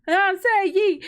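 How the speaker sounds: high, loud hype-up chant; call and response. Pretend. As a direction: lead the crowd in a rowdy yee-haw chant. Leading a yee-haw call and response.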